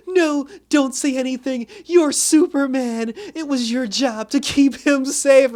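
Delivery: high, loud, crying outburst; mock tearful teen. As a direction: ham up a teenager's tearful blame. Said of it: "and goes".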